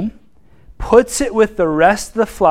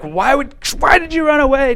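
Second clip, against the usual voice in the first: mock scolding shepherd; 8 semitones higher than usual.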